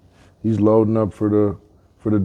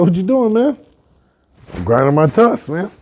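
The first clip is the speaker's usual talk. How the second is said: lightly acted, higher-pitched animal chat; animal banter; 10 semitones higher, 3 dB louder than usual. Storyteller voicing a fox questioning a tusk-grinding boar, higher animal banter. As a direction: raise the pitch for casual back-and-forth.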